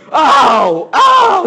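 loud, climbing cry of pain; pinned man crying out from the ground; pained yelp. Retold or imitated. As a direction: yelp as if your head is pinned.

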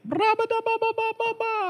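very high, rolled babble; mocking gibberish voice. Shown as a demonstration. Mocks people's speech as gibberish.

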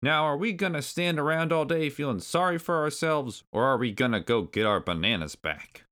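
high, impatient granddad voice; Cranky Kong. A narrator's reading. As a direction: chivvy them along like a crabby elder. Cranky granddad hurries them.

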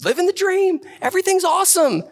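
very high, hammed-up fake cheer; mock-perky church small talk; pretending all's fine. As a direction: brush it off with forced enthusiasm. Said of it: "pressure to pretend like everything's fine".

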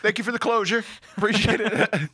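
high-pitched mock gratitude with laughter; grateful loser. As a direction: thank him politely in comic surrender. Acts out loser thanking puncher.

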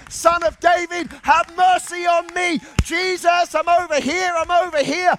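high, loud desperate cry for mercy; calling out. Acted. Blind man calling out to Jesus over the crowd. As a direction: shout across the crowd to be noticed.

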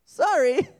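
very high, loud singsong; flippant mock apology. Lazy person excusing themselves, flippant high apology.

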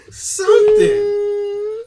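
high, long drawn-out wail; exasperated cry. Real, not acted. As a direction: stretch the word out, loud and very high. Exasperated plea for any match at all.

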